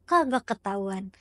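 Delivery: low, unbothered reply from the healer; flat dismissal. Acted. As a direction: brush the worry aside. Healer dismisses the client's worry.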